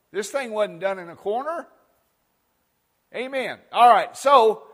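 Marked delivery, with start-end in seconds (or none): 0.1-3.6 ringing preacher's declaration, corner lifted; preaching. Acted. Preacher reminding the congregation, full voice. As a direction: declare it to the room.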